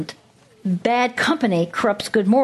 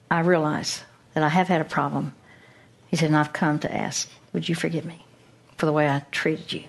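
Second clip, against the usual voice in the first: soft repentance; 5 semitones lower, 4 dB quieter, faster than usual. Retold or imitated. Repentant man asking forgiveness.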